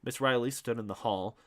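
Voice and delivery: ordinary delivery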